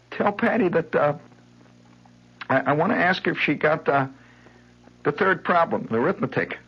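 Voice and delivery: put-on high, halting small-boy excuse; fumbling little boy. Boy fumbles for a reason to talk to Patty. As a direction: make something up on the spot.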